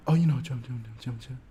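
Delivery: mumbled dodge. Retold or imitated. Evasive mumble fading out.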